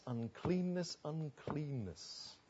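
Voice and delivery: very soft, fading echo; hushed, dwindling self-reproach; fading repetition. Acted. Echoing the sense of being consumed.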